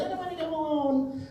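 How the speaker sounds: a light imitation of her pleading; girlfriend pleading. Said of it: "She's like".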